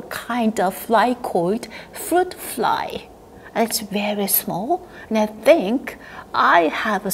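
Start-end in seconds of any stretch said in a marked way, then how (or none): 1.9-4.9 whispering. Acted. Secret aside to kids.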